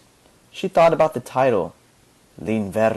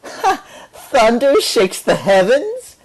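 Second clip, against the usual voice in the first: high squeal then slow, louder teasing; giggling mockery; 11 semitones higher, 5 dB louder, slower than usual. A narrator's reading. Violist teasing Candida's poem, giggling and mocking. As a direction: giggle and mock the line. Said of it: "giggled the violist".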